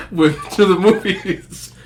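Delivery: slow words through laughter; giggling it out; laughing. Real, not acted. Comedian laughing about the entanglement, laughing.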